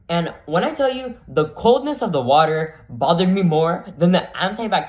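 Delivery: loud, dramatic emphasis on the cold; emphatic telling. Real, not acted. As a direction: stress the drama hard. Emphatic storytelling punch.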